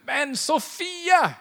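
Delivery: drawn-out annoyed cry; exasperated call. Retold or imitated. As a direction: call out the name in mock frustration. Frustrated by an email, calls name.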